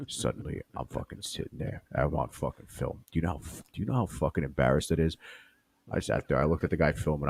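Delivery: low, hushed storyteller rasp; Joey Diaz. Pretend. Deer as Joey Diaz recounting eating the snake, low and hushed. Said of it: "That's not a bad D S".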